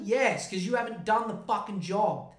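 ordinary delivery

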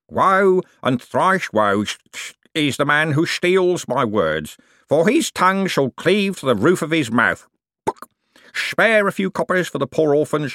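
high, declaimed curse turning to begging; hammed-up preaching through whistling false teeth; mock preacher beggar. Cribbins preaches and begs.

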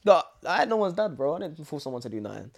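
ordinary delivery

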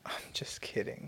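soft whispered tease; whispering. Real, not acted. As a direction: whisper it as a joke. Teasing retraction.

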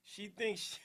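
ordinary talk, nothing marked

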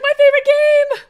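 a dog-like dragon overjoyed at its game; gleeful dragon voice. Retold or imitated. Viewer voices dragon loving fetch, high and loud. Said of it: "He's like".

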